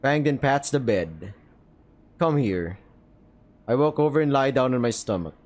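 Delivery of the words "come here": put-on lower, gentle bird girl beckoning; Fang's low voice. Fang speaks in dating game.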